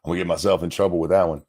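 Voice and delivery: ordinary talk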